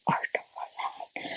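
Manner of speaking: slow, fading whisper; hushed, drawn-out whisper. Real, not acted. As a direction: drop into an adoring whisper. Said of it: "I don't know why I'm whispering".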